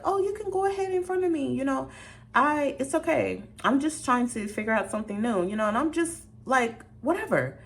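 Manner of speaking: high, sweet and obliging; way up high, overly nice to a stranger; bright high polite customer; sugary high voice. Retold or imitated. Offering place to mother and kid.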